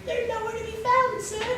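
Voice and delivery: high, soft, put-on British lady voice; British accent. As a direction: play the woman in a jokey British accent. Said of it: "'cause she's British".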